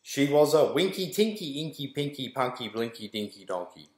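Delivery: usual speaking voice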